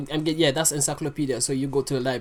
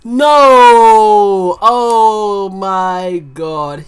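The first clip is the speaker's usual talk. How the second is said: loud, drawn-out oh my god; stunned disbelief; 4 semitones higher, 14 dB louder, much slower than usual. Real, not acted. Reactor floored by a rap bar, loud stunned cry. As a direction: blown away, yell it out and stretch it.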